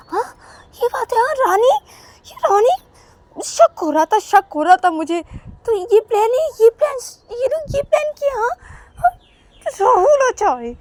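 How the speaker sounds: high, loud angry voice; furious rant. Acted. Angry husband on the phone, furious high shouting. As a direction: rage at the betrayal, shouting. Ravi furious at Rahul on call.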